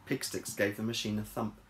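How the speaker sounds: plain reading voice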